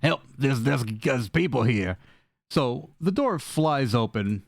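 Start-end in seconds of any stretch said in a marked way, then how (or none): 0.0-2.0 voice breaking, faked. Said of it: "your voice breaks halfway through it".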